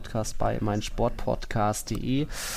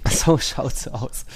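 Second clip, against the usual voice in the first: laughing; much slower than usual. Real, not acted. Laughing echo of partner's phrase.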